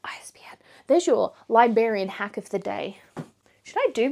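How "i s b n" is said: the letters I S B N breathed as a bare whisper. Real, not acted. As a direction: whisper I S B N as a sly afterthought. Whispered repeat of her tip.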